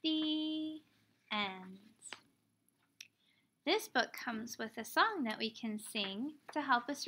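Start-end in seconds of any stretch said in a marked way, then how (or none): none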